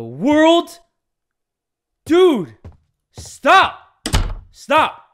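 loud, high yelp of alarm; alarmed shout. Real, not acted. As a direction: cry out to halt it. Frightened by the game.

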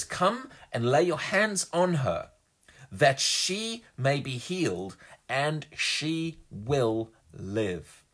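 slow, deliberate quote with weight on each word; measured certainty, drawn out to the end; certain, confident declaration. Acted. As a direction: declare it slowly as settled fact. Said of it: "Notice the certainty. Notice the confidence".

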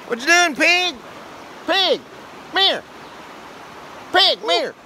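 high, loud call across the water; calling the dog. Real, not acted. Calling Pig over from the water, a high loud call.

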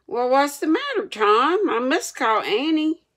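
high, worried urgency; acted anxious young brother; alarmed boy.

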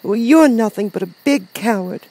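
put-on high-pitched accusing child; scornful retort. A narrator's reading. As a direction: accuse the lion crossly, as a girl. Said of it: "she retorted".

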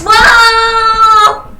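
high, loud joyful yell; excited shout. Real, not acted. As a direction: shout with pure joy. Upgrade succeeds.

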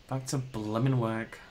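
slow, soft, weary grumble; deflated. Real, not acted. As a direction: drag it out, fed up. Same words, deflated retake.

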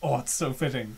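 usual speaking voice

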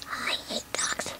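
breathy secret whisper of "I hate dogs"; whispered aside. Pretend. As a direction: hiss "I hate dogs" under your breath, no voice. Doll secretly admits hating dogs.

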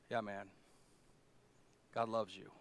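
soft and low, gentle; tender reassurance. Real, not acted. Pastor quietly reassuring the congregation, tender. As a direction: say it quietly, like a comfort. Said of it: "repeating the answer softly".

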